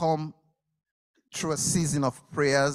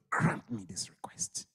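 high, hushed begging whisper; whispered plea; 8 semitones higher, 4 dB quieter than usual. Acted. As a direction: beg in a whisper. Mrs Zebedee begs quietly.

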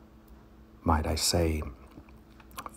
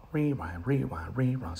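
tape rewind; 8 semitones higher than usual. Pretend. Comic rewind bit.

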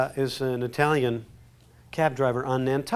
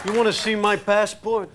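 higher, louder New York Polish voice; New York Polish accent; 6 semitones higher, 5 dB louder than usual. Shown as a demonstration. Guest reads a line for the audience test, New York Polish accent. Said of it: "A New York Polish guy saying that".

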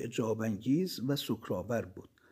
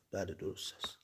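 much softer, lower yes of agreement; soft lowered affirmation; quiet reply; 6 semitones lower, 11 dB quieter than usual. A narrator's reading. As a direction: drop the voice and simply confirm درست است.

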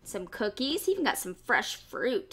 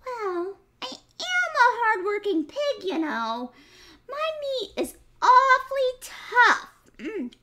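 much higher, sweet piglet, slow on massage me; clever piglet voice; 11 semitones higher, 6 dB louder than usual. Acted. Piglet sweetly talks the fox into massaging him.

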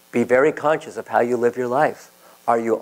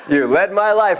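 higher, laughing through the words; 7 semitones higher than usual. Retold or imitated. Man thanking the Lord, laughing in relief. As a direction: let the laugh carry the words.

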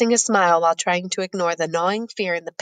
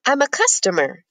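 cheerful, pitched higher, a little lifted; bright; 6 semitones higher than usual. A narrator's reading. Beggar boy answering the rude egg vendor, brightly. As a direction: chirp it like nothing can bother you. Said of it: "says Sadu brightly".

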